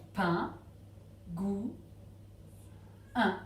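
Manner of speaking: PAIN, GOÛT, UN as three isolated beats with long gaps; syllable by syllable. Shown as a demonstration. Teacher placing crosses, PAIN, GOÛT, UN as separate syllables. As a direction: leave a clear pause after each of PAIN, GOÛT, UN. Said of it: "trois syllabes dans pingouin".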